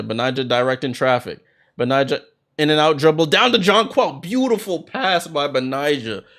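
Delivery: brisk live game call, names punched; play-by-play. Acted. Commentator calling the game for viewers, in lively play-by-play.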